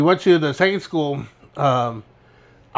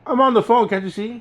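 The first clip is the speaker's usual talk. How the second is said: higher-pitched, put-upon protest; mock indignation; 7 semitones higher than usual. Student on a fake phone fending off the teacher, mock indignant. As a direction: play at being interrupted mid-call.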